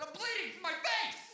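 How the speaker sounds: high-pitched mock panicked shout; panicked yell. Bleeding kid to older brother, hammed-up yell. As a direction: cry out an injury to someone far off.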